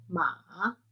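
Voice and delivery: long MA dipping, then rising; third tone. Shown as a demonstration. Teacher running through the tones, long dipping "ma". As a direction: stretch "ma" down and back up like a long question. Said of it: "el tercero".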